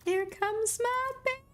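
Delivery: high, slow sing-song coo. Real, not acted. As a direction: coo over a favourite. Greets favourite game character fondly.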